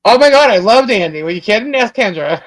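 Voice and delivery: usual speaking voice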